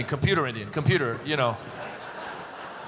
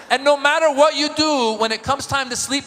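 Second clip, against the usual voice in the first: usual speaking voice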